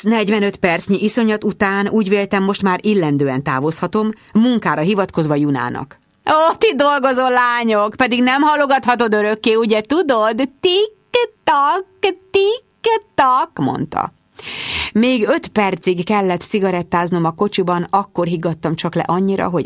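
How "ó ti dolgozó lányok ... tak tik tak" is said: magas, éneklő kioktatás; kioktató nénike. A narrator's reading. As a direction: tiktakolj magasan, tagoltan, mint az óra. Juna kioktatja a dolgozó lányt. (magas hangú, csipkelődő nénike)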